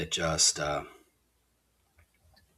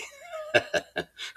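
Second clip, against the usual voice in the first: breathy run of chuckles after own remark; amused chuckle; 3 dB quieter than usual. Real, not acted. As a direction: chuckle to yourself, pleased. Amused by his own political jab.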